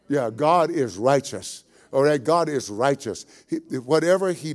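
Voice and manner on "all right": usual speaking voice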